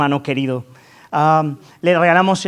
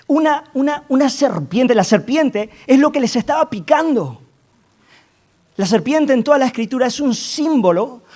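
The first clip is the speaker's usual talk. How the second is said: higher, amazed, emphatic voice; incredulous preaching; 7 semitones higher than usual. Acted. Preacher to church marveling at the serpent, incredulous. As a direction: marvel at how absurd it seems. Preacher mocks absurd bronze serpient.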